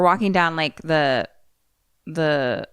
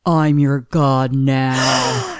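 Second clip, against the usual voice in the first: slow, low monster declaration; menacing monster voice; 4 semitones lower, 3 dB louder, much slower than usual. Acted. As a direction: growl it like a god-monster. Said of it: "Ick just says".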